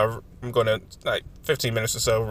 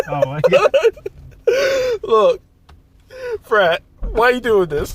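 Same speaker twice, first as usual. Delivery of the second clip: laughing exasperation; 12 semitones higher, 8 dB louder than usual. Real, not acted.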